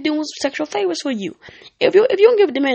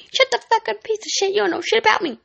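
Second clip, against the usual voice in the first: high, mock furious rant at a partner; mock angry yelling; 7 semitones higher than usual. Girlfriend yelling at her man, mock fury. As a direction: play an angry girlfriend lashing out, pitched up. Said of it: "don't be like".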